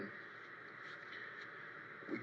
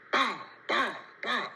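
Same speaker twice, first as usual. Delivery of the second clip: high, sharp spoken bangs; imitated gunshots; 9 semitones higher, slower than usual. Storyteller imitates shots he heard, high barked bangs. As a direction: punch out mock gunfire, separate bursts.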